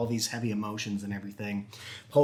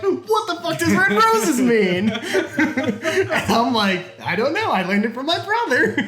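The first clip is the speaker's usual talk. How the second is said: much higher, louder, laughing; laughing retelling; 8 dB louder than usual. Retold or imitated. Teller recalling fifth grade classmates, laughing. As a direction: squeal it out through laughter.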